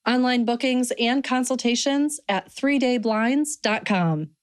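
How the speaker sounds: smooth, even radio ad read; sponsor read. Acted. Radio host reads sponsor credits to listeners, polished ad read.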